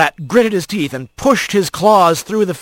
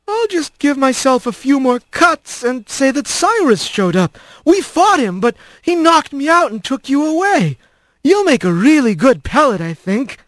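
higher, coolly menacing hawk; scheming villain voice; 6 semitones higher than usual. Acted. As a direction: plot it out with calm menace. Otho scheming aloud.

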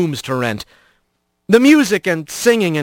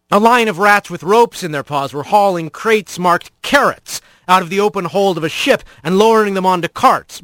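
plain reading voice

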